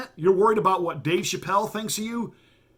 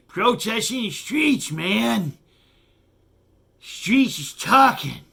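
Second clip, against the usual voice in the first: higher, slow and slurred mock stoner; Dave Chappelle; 4 semitones higher, 4 dB louder, slower than usual. Host mocking a comedian's concert rant, higher slurred impression. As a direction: drag it out, slurring like you're half asleep. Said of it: "Was slurring".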